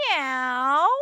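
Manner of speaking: mewing cow; cat meow. Acted. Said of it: "said the cow".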